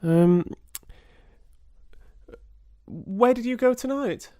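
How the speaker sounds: high, hesitant, wary question; high uneasy voice. Acted. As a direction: probe nervously, high.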